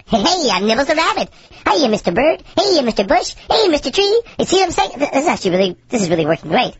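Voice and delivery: sped-up helium cartoon voice. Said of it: "My voice is so high".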